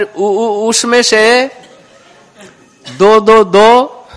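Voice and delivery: put-on child stammer breaking into giggles; stammering baby talk. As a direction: stutter through it, laughing along. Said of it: "तुतलाते हुए बोलने लगे". (हंसते हुए तुतलाती बच्चे की बोली)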